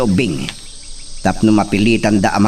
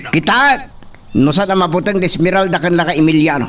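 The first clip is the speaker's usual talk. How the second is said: high, raised voice giving orders; commanding orders; 5 semitones higher than usual. Acted. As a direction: order the henchmen about, high and forceful. Villain barks orders to henchmen.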